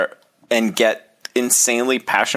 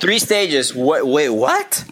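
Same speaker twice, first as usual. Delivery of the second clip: incredulous; 4 semitones higher, slower than usual. Real, not acted. Disbelief at three stages.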